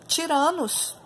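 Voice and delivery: ordinary delivery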